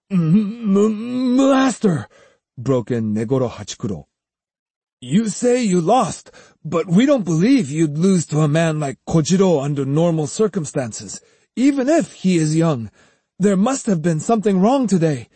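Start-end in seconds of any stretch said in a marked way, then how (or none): 0.1-2.1 high, shaky stammer breaking in. Acted. 5.0-15.3 a lightly raised, pressing student voice; earnest young student. Acted.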